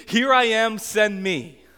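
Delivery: loud, high and eager, a hand shot up; Isaiah's fired-up "Here I am, send me"; pumped-up eager volunteer. Retold or imitated. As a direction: burst out like a man who can't wait to be sent. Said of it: "is all pumped up".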